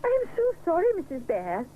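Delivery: high, flustered apology; mild dismayed cry. Acted. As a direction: blurt out sorry in dismay. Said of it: "he cries".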